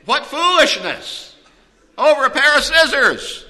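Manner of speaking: high, loud preacher's outcry; incredulous exclamation. Real, not acted. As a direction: cry out at the sheer folly. Preacher exclaims at the couple's folly.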